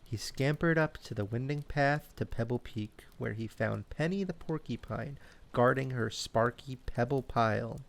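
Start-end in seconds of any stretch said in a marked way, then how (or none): none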